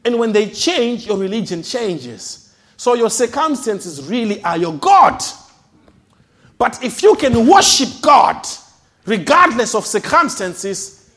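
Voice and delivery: loud, high revival preaching; rising pulpit fervor; full-force preaching. Acted. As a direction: drive it home to the congregation.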